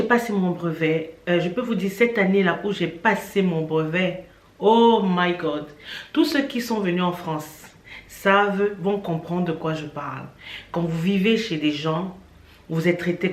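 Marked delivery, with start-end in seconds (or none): none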